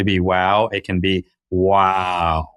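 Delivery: second "wow" stretched long and slow, "woooooooow"; drawn-out wow. Shown as a demonstration. Speaker contrasts plain wow with long wow, dragging the second "wow". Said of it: "imagine wow with a W and nine O's".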